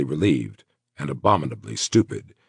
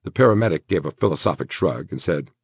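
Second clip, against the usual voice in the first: ordinary delivery, nothing marked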